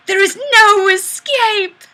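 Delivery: loud, high, slow menacing line; menacing boom. Acted. Streamer reading a viewer's comment as a slow loud threat. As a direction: threaten them slowly and loudly.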